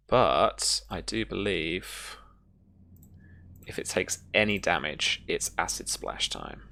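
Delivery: usual speaking voice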